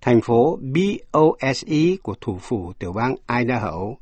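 normal talking voice

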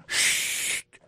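whispered shht; shushing. Shown as a demonstration. Teacher showing how she shushes people, whispered. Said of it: "m ap di moun".

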